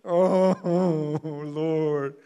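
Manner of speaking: loud mock whine to heaven; whiny. Spiritual whiner crying to God, loud hammed-up whine. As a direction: moan it out, hammed-up and drawn-out. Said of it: "I'm so whiny spiritually".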